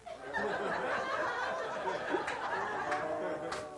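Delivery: long high real laugh; hearty laugh. Real, not acted. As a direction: burst out laughing at yourself. Laughing at his own story.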